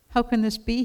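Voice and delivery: high-pitched, amazed cry; crying out in wonder. A narrator's reading. Smiling king amazed at his own happiness, crying out. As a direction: exclaim it high, astonished by joy. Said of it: "he cried".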